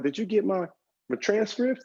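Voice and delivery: high-pitched disbelieving student; incredulous question. Retold or imitated. Failing student questions praising teachers, high and incredulous. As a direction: ask it way up high, in doubt.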